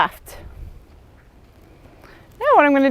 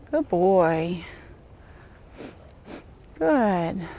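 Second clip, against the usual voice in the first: soft praise; 5 semitones lower, 8 dB quieter, much slower than usual. Real, not acted. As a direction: soothe the horse quietly. Praising horse during lunging.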